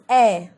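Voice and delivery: short open "ए" as in apple; vowel sound demo. Shown as a demonstration. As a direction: say ए as an open, held 'ae'. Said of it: "ए की जो आवाज़". (ए की खुली आवाज़, जैसे apple में)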